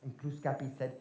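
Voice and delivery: usual speaking voice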